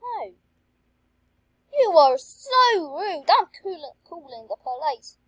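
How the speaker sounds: hammed-up offended shopkeeper, slowing down; indignant refusal. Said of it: "I say, I say".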